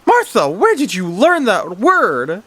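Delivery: high, drawn-out scolding question; high-pitched parental disbelief; shocked mother. A narrator's reading. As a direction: stretch it out, high and aghast. Mother questions cursing child.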